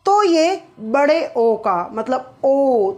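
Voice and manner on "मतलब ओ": ओ drawn out long and slow. Shown as a demonstration. Teacher stretching the long vowel for students. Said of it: "थोड़ा सा हम इसको खींचेंगे".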